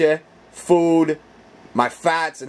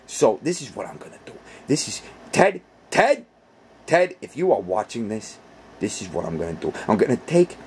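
ordinary talk, nothing marked

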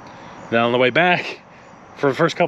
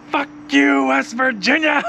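high yelled taunt out the car window; high mocking yell, arm out the window; 12 semitones higher than usual. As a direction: yell it up high like flipping off the state. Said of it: "and I'm like".

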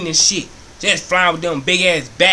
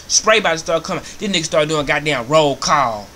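usual speaking voice

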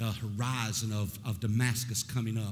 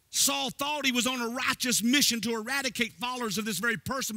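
much higher sing-song preacher cadence; preaching at full force; 12 semitones higher, 4 dB louder than usual. Acted. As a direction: chant the sermon with rising fervor. Preaching rises into sung cadence.

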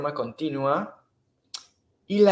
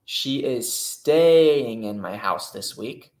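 slow read option; 4 dB louder, much slower than usual. Shown as a demonstration.

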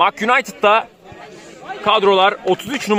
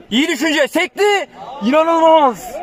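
excited commentator; 7 semitones higher than usual. Acted. Near miss in commentary.

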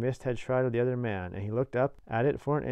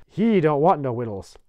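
loud, higher rustic dismissal; gruff convict; 5 semitones higher, 11 dB louder than usual. A narrator's reading. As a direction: blurt it loudly as a rough convict.

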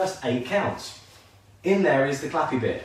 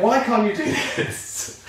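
mock teasing friend; 8 semitones higher, slower than usual. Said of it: "He was like".

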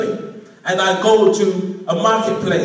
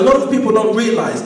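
ordinary talk, nothing marked

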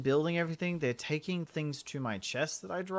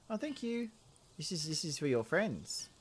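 ordinary talk

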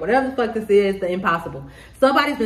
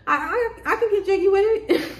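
high, giggly comic voice; playful approving voice; 11 semitones higher than usual. Acted. Reacting to the weird genuine friend, playful high approval. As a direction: squeak it through a laugh.

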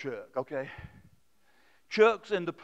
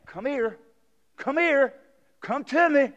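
gentle invitation; 7 semitones higher, 4 dB louder, slower than usual. Retold or imitated. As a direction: invite them in tenderly. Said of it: "Jesus is just saying this".

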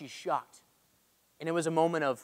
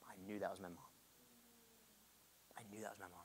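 hushed retelling; 6 semitones lower, 25 dB quieter, much faster than usual. Retold or imitated. Dad's awed memory, retold.

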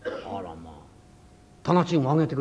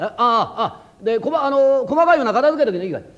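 慌ただしく; 6 semitones higher, 6 dB louder than usual. Acted. 慌てて出かける亭主.

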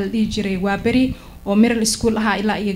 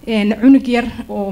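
ordinary talk, nothing marked

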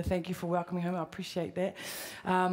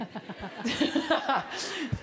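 laughing; 6 semitones higher than usual. Real, not acted. Laughs at the "Z" joke.

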